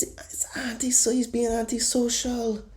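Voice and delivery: mock whisper of the euphemism; whispered aside. Mock official whispering the polite word, high. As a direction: whisper it like a dirty secret.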